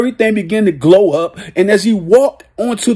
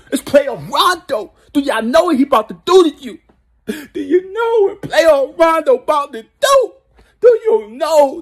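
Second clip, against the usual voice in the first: excited laughter; 7 semitones higher than usual. Real, not acted. Hyped laughing taunt at opponents.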